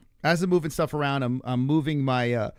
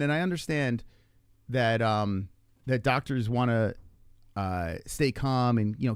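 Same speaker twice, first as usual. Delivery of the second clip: normal talking voice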